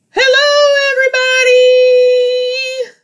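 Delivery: very high, loud sung Grover hello; Grover greeting. Acted. Teacher as Grover greeting the class, high sung hello. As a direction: squeal it out as the furry monster.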